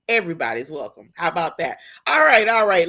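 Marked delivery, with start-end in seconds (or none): 2.1-2.9 loud, pumped-up all right; excited. Real, not acted. Host hyping up her live viewers, loud and excited. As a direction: hype the crowd up, loud.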